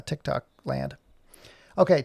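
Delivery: ordinary talk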